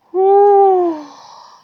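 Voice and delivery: loud, high sigh; a loud exasperated sigh; big sigh. Real, not acted. Loud sigh while working.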